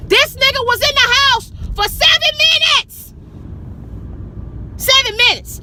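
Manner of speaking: very high, loud shout of disbelief; outraged yell. Real, not acted. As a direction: shriek it in sheer exasperation. Outraged at the long wait.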